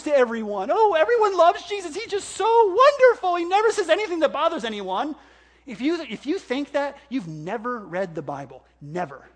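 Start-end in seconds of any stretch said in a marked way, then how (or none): 0.7-5.2 high, loud sing-song mockery; mocking naive view. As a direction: parody a gushing believer. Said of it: "people like to paint a picture".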